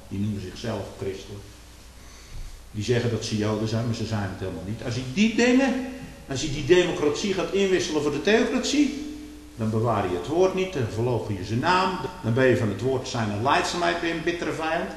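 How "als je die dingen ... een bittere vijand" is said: loud, high, rising sermon fervour; fiery preaching. Acted. Preacher warning his congregation, preaching at full force. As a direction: thunder it from the pulpit.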